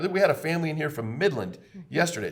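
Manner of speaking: usual speaking voice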